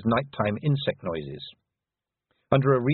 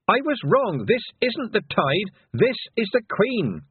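high-pitched cry of warning; urgent yell; 11 semitones higher than usual. A narrator's reading. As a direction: call out the danger in alarm. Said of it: "Tiffany yelled".

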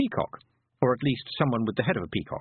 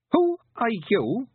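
high, slow, imperious demand; contemptuous boy; 12 semitones higher, 4 dB louder, slower than usual. A narrator's reading. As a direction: ask it haughtily, high and drawn out. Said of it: "He made it sound like".

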